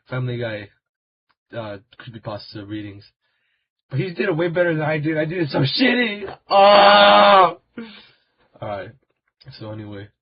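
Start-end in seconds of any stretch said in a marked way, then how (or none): 3.9-6.4 whiny fake sob, louder and higher; mock crying. Mock despair over bad video. 6.5-7.6 sudden loud high yell of frustration; frustrated scream. Pretend. Frustrated outburst.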